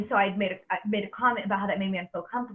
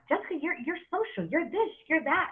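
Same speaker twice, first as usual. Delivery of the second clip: pitched way up, imitating people gushing over her; mock cheery praise; 7 semitones higher than usual. Mimicking people who call her social, in a much higher mock-cheery voice. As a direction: parrot their gushing compliments, much higher.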